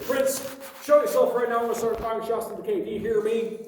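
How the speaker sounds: high, loud storyteller's shout; acted shouted threat into the dark; retold panicked yell. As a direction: yell it out high, acting rattled. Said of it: "got shaky and yelled out".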